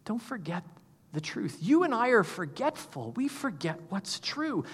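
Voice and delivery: soft, gentle reminder from his wife. Retold or imitated. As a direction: say it softly, like a wife calming a spiraling husband. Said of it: "who really gently said".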